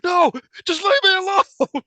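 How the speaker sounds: slow, high, loud comic cry; high-pitched mock protest. Mime to his pretend partner, high hammed-up protest. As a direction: play a hammed-up plea to be left alone. Acting out mime pleading to robber.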